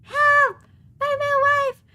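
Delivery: high, loud playful screaming for help; mock yell for help. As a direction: scream it like he's been stolen. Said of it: "I'm gonna yell for help".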